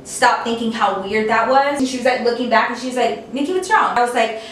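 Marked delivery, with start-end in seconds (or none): 3.3-4.0 concerned friend. Retold or imitated. Friend asks what is wrong.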